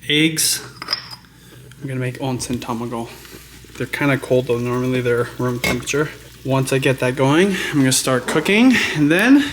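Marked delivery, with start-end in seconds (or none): none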